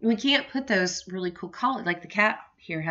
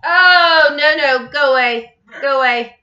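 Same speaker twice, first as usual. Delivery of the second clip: louder, higher urgent no-no; shooing loudly; 7 semitones higher, 12 dB louder than usual. Real, not acted. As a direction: shoo the dog off firmly. Shooing the dog away.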